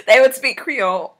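amused insistence through laughter; laughing. Real, not acted. Laughing as she corrects Dave on Creole.

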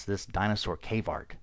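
ordinary talk, nothing marked

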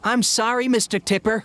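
high-pitched, apologetic little engine; Percy apologizing. A narrator's reading. Engine to postman over the broken bicycle, apologizing. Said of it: "apologized Percy".

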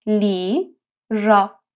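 لیرا stretched very slowly; slow syllable demo. Shown as a demonstration. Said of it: "تلفظ این حرف ل". (لیرا آهسته و کشیده)

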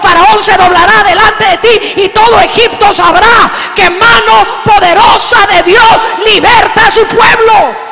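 shouting voice of God at full force; God's commanding shout. Acted. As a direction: thunder it out as God sending Moses. God sends Moses to Pharaoh.

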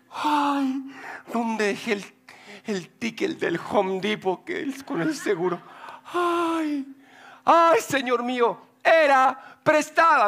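fretful, slowing at the end, mock panic; panicked woodcutter. Woodcutter panics about the borrowed axe, hammed-up worry. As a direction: play the man frantically hunting the receipt.

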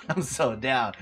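ordinary talk, nothing marked

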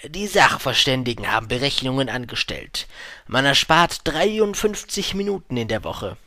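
put-on merchant's sales pitch. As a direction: sell it with official importance.

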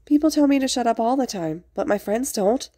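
high-pitched mock-hurt complaint; put-upon, playing for sympathy; dramatic sniffling. A narrator's reading. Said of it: "Izuku sniffled dramatically".

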